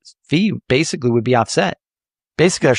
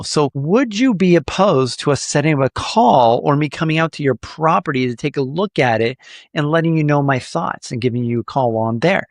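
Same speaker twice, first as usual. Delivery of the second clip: usual speaking voice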